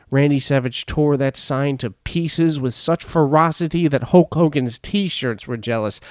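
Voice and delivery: usual speaking voice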